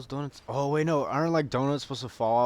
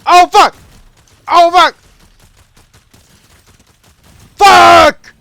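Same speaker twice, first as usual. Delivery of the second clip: full-volume, high-pitched screams; panicked swearing; 24 dB louder, much slower than usual. Real, not acted. Gamer suddenly attacked in the match, panicked screamed swearing. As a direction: scream the curse as things go wrong.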